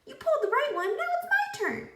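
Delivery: high, squeaky talking pigtail. A narrator's reading. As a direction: play the cheeky pigtail, way up high.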